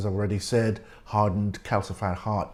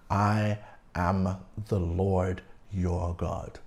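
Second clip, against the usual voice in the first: slow, low, weighty scripture reading; emphatic divine declaration; 6 semitones lower, 5 dB quieter, slower than usual. Acted. God seals his commandments to Israel, slow and emphatic. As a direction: lower and softer, each word given space. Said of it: "emphasizing commandments with these".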